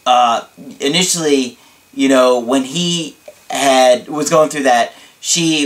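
ordinary delivery

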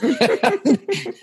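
sudden high laugh at the memory; high burst of laughter. Real, not acted. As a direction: let out a quick, high laugh. Laughs at friend's remark.

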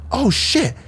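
a sudden high-pitched burst of disbelief; retold surprise. Retelling to his partner how shocked he was, high surprised oh shit.

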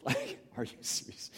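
breathless whispered are you serious; hushed, stunned father; whispered disbelief. Retold or imitated. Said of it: "if I'm Jairus".

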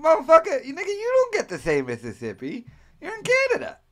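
high-pitched, exaggerated indignation; comic indignant rant, squeaky high; mock pissed-off outburst. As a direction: act out being outraged, pitch jumping way up. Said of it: "I got mainly pissed".

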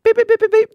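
high loud machine beeps; imitated beeping. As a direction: beep like an alarm. Imitated camera alert beeps.

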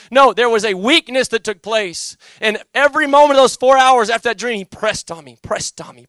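loud, high, driving sermon voice; building, fervent pulpit delivery; preaching at full force. Acted. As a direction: hammer each line home like a revival preacher. Preaches the pressing of conviction.

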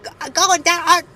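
put-on Cockney accent. Co-host imitating old Dickens characters, botched Cockney accent. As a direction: attempt a Cockney accent, breaking up into laughter. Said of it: "her Cockney accent".

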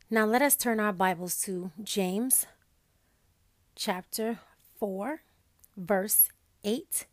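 ordinary talk, nothing marked

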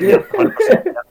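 very high, loud laughing shout; laughing agreement. Retold or imitated. The whole lobby agreeing, very high, loud and laughing. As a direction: cheer it through laughter. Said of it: "everyone was like".

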